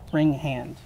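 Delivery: ordinary delivery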